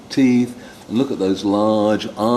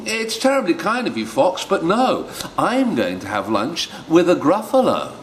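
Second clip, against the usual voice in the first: much higher, polite little mouse; 7 semitones higher than usual. Acted. The mouse turns down the fox, high squeaky mouse voice. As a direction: chirp like a clever little mouse. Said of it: "mouse answers the fox".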